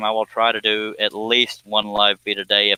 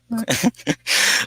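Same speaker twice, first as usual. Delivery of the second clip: high laugh; 13 semitones higher than usual. Real, not acted. Bursts out laughing.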